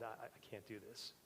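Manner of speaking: retold choked-up give-up line; choking quiet plea. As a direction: mutter it as if out of breath. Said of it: "I'm choking".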